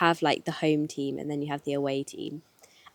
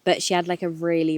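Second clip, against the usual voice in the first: normal talking voice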